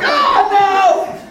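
high, loud comic dismay; mock mortified wail. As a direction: wail in exaggerated embarrassment. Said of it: "enter his own navel and disappear".

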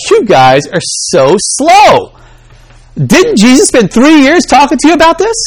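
high, slow exasperated scolding; a teacher fed up with his pupils; impatient Jesus. Retold or imitated. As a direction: drag out the disbelief at slow students. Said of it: "he does get impatient with them".

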